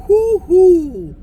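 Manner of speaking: high, loud happy hoot; excited whoop. Real, not acted. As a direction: whoop it out with glee. Excited whoop starting drive.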